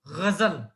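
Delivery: गजल with a throaty gh and a z sound, Urdu style; Urdu ghazal. Shown as a demonstration. Teacher demonstrates the correct Urdu ghazal to students, gh and z. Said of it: "इस तरह से".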